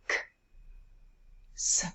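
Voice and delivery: K and s as bare consonant sounds; K... s, widely spaced, no letter names; sounds in isolation. Shown as a demonstration. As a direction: release K, pause, then hiss a short s. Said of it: "look at the second syllable".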